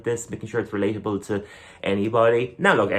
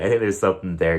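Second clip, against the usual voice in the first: normal talking voice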